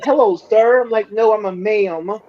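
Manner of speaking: ordinary delivery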